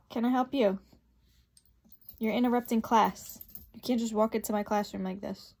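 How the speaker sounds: loud, stern teacher telling off; stern scolding. Real, not acted. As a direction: scold the intruders loudly.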